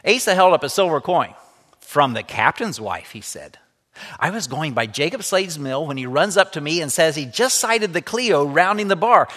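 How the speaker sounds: lively read-aloud storytelling voice; story narration. A narrator's reading. Teacher reading a story to the class, lively read-aloud. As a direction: bring the story alive for the students.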